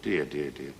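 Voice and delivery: very low, soft tutting; hushed, low commiseration; pitying mutter. Acted. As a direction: mutter it low under your breath. Pitying aside in pub joke.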